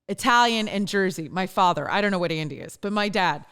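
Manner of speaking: ordinary talk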